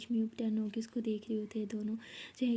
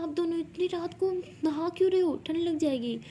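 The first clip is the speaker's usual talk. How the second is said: fussing maid; 7 semitones higher, 4 dB louder than usual. Acted. Maid scolds girls in pool.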